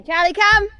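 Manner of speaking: high loud call to a dog. Real, not acted. Calling the dog back.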